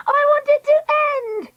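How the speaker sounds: very high, contained scream; screamed tantrum. Acted. Treacle stamping her foot again, high screaming tantrum. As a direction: shriek it like a fed-up child. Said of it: "Treacle screamed".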